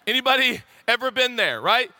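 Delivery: ordinary delivery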